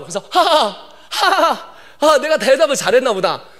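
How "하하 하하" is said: imitated classroom laughter; mock "ha ha" of amused classmates; classmates laughing. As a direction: act out the kids' laughter. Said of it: "애들이 막 웃더라고".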